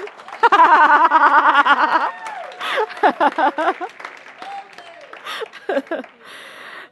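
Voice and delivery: loud, long laughter of pure joy; long joyful laugh. Real, not acted. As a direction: let the laugh roll out, delighted. Joyful laughter after son's promise.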